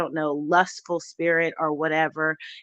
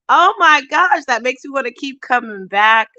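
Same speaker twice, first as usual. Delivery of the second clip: overjoyed exclaim; 7 semitones higher, 10 dB louder than usual. Real, not acted. Vlogger thanking a loyal subscriber, bursting with joy. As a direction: burst out high and loud with delight.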